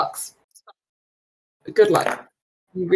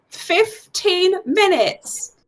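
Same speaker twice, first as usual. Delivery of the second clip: high, drawn-out yell without much volume; calling out; 10 semitones higher, much slower than usual. Acted. Library lady warns visitors of the time, calling out. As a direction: stretch it out as a high-pitched call. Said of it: "The lady yells out to you".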